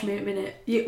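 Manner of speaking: usual speaking voice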